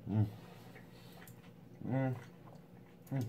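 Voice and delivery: eating and savoring food; chewing food. Real, not acted. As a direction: mouth full while humming. Seen 1: Food put in mouth at -2.0; mouth closed, chewing during "음.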